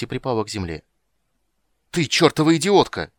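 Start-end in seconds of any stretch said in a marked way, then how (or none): 1.9-3.1 high, loud furious yell; angry shout. A narrator's reading. Witcher rebukes the dryad for shooting, shouting angrily. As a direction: yell at someone for their recklessness. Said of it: "крикнул ведьмак".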